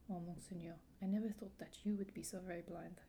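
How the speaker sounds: lightly acted French-accented heroine. Young ward answers her guardian's confession, French accent. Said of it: "I really enjoyed your French accent".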